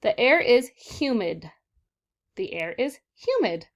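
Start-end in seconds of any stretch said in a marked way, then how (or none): 0.0-1.5 slow and firm, every word set apart; teacher reading the sentence aloud, slow and clear; slow model reading. Shown as a demonstration. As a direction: read it slowly so the class can follow. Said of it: "read the sentences".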